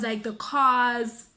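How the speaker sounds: ordinary talk, nothing marked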